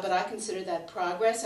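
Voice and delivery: normal talking voice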